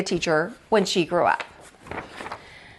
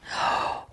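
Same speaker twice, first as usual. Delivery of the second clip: whispered gasp; 9 dB quieter than usual. Acted. Lily counting in the chair.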